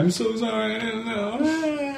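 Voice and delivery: very high mock sobbing apology; crying girl imitation. Kristen apologizing to the prank caller, mock high teary whimper. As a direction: squeak it out like a teary teenager. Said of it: "And she was crying".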